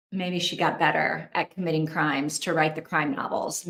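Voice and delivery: normal talking voice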